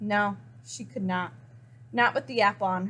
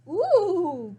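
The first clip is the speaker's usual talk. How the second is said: higher, louder delighted ooh; high surprised ooh; 7 semitones higher, 4 dB louder than usual. A narrator's reading. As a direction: gasp at the sight of lunch. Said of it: "cried Goat".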